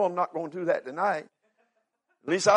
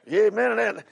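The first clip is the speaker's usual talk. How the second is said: usual speaking voice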